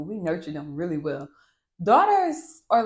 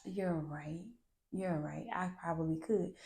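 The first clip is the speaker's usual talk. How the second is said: sincere soft admission; 9 dB quieter than usual. Retold or imitated. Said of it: "And I'm like".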